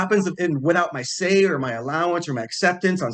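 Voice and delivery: a touch of exasperated outrage; retold anger. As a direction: replay your own upset rant, lightly hammed. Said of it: "I was so pissed at that point".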